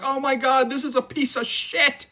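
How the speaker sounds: high mock fan outcry; mock upset fan. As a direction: play a fan wailing that the film is bad. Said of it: "and saying, like".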